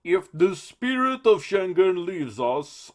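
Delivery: high, loud, slow goofy voice; silly Juncker voice. Retold or imitated. Host mocks the EU president, silly put-on voice. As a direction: make the statesman sound ridiculous. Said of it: "I'm gonna just make him sound silly".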